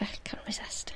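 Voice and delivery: hushed, barely voiced confession; whispered aside. Real, not acted. Podcaster giving in to reading a favourite book, whispered aside. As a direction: drop right down to a whisper.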